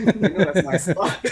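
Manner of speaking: loud, slow, through laughter; laughing retold quote. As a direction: crack up while quoting the other guy. Retelling confrontation while laughing.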